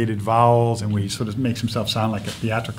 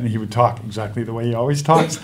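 ordinary delivery

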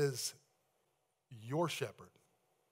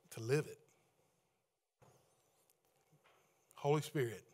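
prayer; 4 semitones lower than usual. Acted. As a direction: pray gently on behalf of the church. Leads congregation in prayer.

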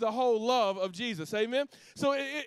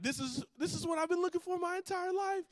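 put-on giddy boyfriend who can't believe his luck; excited Jack; 6 semitones higher than usual. Jack tells the audience about Grace, giddy and high. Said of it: "He was so excited about Grace".

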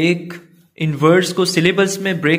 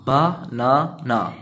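slow syllable split; 2 semitones lower, much slower than usual. Shown as a demonstration. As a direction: stretch banana into its syllables. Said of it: "तीन syllable हैं".

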